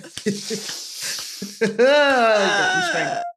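a long laugh that rises high. Real, not acted. Mother laughs at her daughter's cheeky line, long and high.

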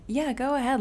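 high, breezy mock encouragement; mock cheerleading.

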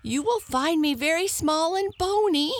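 high, fast squeaky hare voice; squeak. Acted. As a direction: squeak it out like a tiny frightened hare. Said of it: "squeaked the hare".

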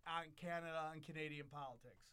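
ordinary talk, nothing marked

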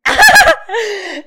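sharp, high, loud gasp of delight. Real, not acted. Friend's mugshot shown on screen.

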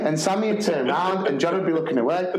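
ordinary talk, nothing marked

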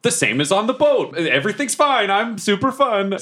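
high-pitched fake cheer; pretending all is fine. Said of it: "he's pretending that it's".

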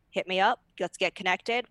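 ordinary delivery

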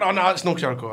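ordinary delivery

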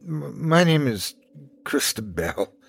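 put-on lightly shivering child's stammer; chattering teeth. A narrator's reading. Freezing child gives her name, teeth lightly chattering. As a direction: stumble on the first sound as if freezing. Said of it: "the child said through chattering teeth".

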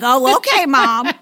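sarcastic. Retold or imitated. Mock reply to mom.

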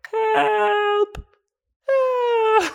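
very high, wailing mock cry; hammed-up cries for help. Campers wake to his cries, high mock wail for help. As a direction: squeal it out like a helpless kid. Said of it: "faint cries for help".

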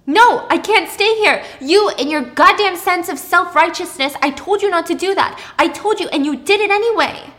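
high, loud accusation; angry outburst. Acted. Thomas blaming Pippa as he leaves, loudly furious. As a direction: lash out with blame.

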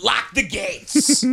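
high, drawn-out gatekeeper cry; hammed-up command to seal the castle; slow, high-pitched theatrical call; mock proclamation. Mock proclamation after co-host leaves.

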